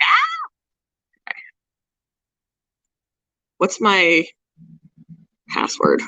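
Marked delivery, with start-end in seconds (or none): 0.0-1.3 short high squeal of surprise; startled squeal. Retold or imitated. Retelling whiskers tickling her ear, a high startled squeal. I was like.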